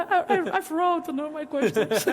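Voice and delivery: sheepish laugh through the words; laughing self-mockery. Speaker admits to co-host she wrote all her questions, laughing. Confessing with a laugh mid-conversation.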